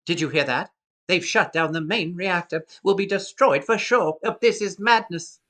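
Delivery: put-on high, agitated English-accented droid; C-3PO. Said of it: "first line of C three P O".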